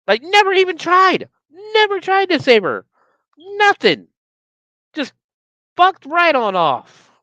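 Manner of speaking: high, loud, indignant rant; outraged rant. Real, not acted. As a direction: she just left her. Outraged at Bianca leaving.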